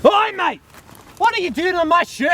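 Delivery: high-pitched, outraged rival host; indignant challenge. Acted. Nature host confronting a rival on his show, indignant. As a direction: call out an intruder on your turf. Acted rival hosts quarrel.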